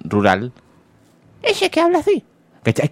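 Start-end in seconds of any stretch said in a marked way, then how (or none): 1.4-2.2 mocking, very high falsetto impression; squeaky whistle voice. Radio panelist mimicking a politician's voice, squeaky falsetto. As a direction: squeak it out way up high, imitating him. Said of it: "tiene una voz así como media de pito".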